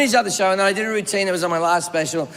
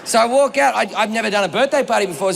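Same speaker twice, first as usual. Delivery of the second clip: normal talking voice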